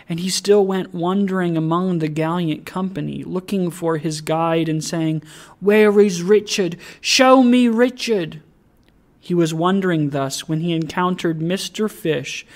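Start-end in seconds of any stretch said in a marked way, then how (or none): 5.6-8.4 higher, louder pleading cry; distressed calling. A narrator's reading. Confused, grieving Trotty calls for his guide, distressed pleading. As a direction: call out urgently for someone lost.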